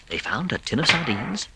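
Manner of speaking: usual speaking voice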